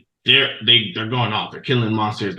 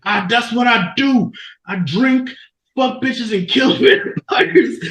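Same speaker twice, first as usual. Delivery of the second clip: high, loud cocky swagger, slowing into a laugh; mock-heroic boast from Belmont; boastful Belmont; 13 semitones higher, 4 dB louder, slower than usual. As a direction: brag like a drunk vampire hunter.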